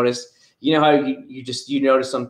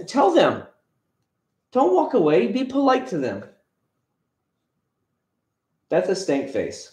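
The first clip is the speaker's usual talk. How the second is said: higher, coaxing parent voice; 6 semitones higher than usual. Real, not acted. Talking to his son.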